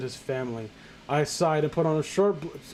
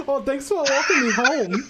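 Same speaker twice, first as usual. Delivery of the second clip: high, laughing mock-feminine voice; feminine [name]; 8 semitones higher, 4 dB louder than usual. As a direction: camp it up as a girly boy, giggling. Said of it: "[name] getting really feminine".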